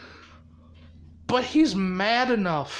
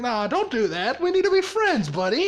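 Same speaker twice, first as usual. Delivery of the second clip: pestering pal; 5 semitones higher than usual. Retold or imitated. Deadpool begging Izuku to be friends, high and wheedling. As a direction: voice swooping up high on the plea. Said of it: "and he's like".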